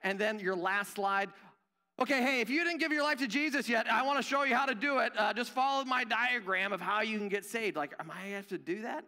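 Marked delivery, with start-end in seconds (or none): 2.0-7.8 high, fast, over-keen pitch; mock eager presenter. Worker evangelizing executives on last slide, mock over-eager. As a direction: rattle off an overeager sales-style presentation, hammed up.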